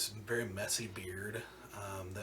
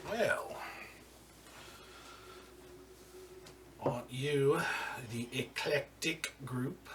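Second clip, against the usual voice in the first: smug crime boss; 5 semitones higher, slower than usual. Acted. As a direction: introduce yourself like you own the room. Boss addresses the party.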